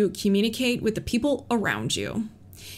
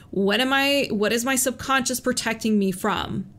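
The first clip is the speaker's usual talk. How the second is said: ordinary talk, nothing marked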